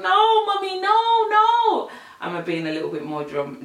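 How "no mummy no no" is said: hammed-up high, loud wail of protest; mock-panicked, very high pleading; dramatic child pleading. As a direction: beg mum like a horrified child. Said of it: "dramatic than that".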